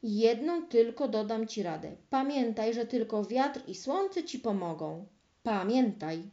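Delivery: usual speaking voice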